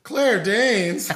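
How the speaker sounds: high, loud incredulous echo of the name; amused disbelief. Real, not acted. To the co-host teasing about her favorite, surprised. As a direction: throw the name back in delighted disbelief.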